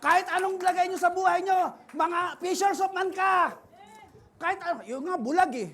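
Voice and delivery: high, loud, fervent sermon climax; preaching. Acted. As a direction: stir them up to fish for souls. Preaching at full force.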